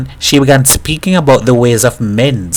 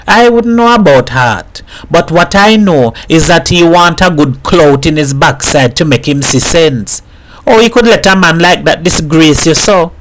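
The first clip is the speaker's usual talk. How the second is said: higher, lively island mother's voice, lightly voiced; a mother mocking her dead husband to a visitor; lightly voiced Trinidadian mother joking; 5 semitones higher than usual.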